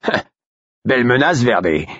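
high-pitched taunting jeer; mock a comrade's empty threat. A narrator's reading. Second guard to Verbet, mocking. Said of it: "d'un ton moqueur".

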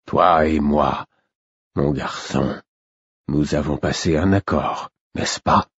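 slow, low, tired king; a weary king asking the boy; frail old king. A narrator's reading. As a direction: drag it out, drained of strength. King speaks slowly from bed.

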